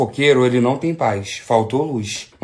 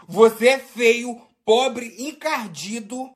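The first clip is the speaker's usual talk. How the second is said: slow, amused higher echo; repeating the line, cracking up; laughing repeat; 5 semitones higher, much slower than usual. Real, not acted. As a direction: savour the insult through laughter. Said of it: "repeats the insult while laughing".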